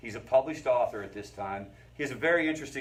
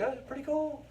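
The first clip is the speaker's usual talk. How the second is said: put-on much higher, light little-girl voice; little girl delight. A five-year-old girl playing in weeds, high delighted voice. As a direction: lift up into a delighted child's squeal.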